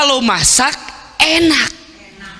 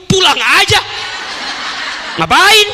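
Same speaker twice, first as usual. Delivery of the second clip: a grumpy husband telling her to go; irritated husband; 10 semitones higher than usual. Retold or imitated. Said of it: "Mungkin kalau suami ibu".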